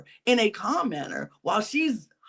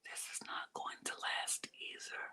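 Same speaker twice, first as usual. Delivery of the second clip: whisper; 19 dB quieter than usual. Real, not acted. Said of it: "aside whisper".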